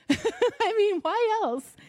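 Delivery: high, amused exclamation; laughing. Real, not acted. Preacher on the donkey ride, laughing disbelief. Laughing rhetorical question to congregation.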